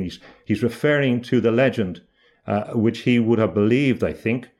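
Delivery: ordinary talk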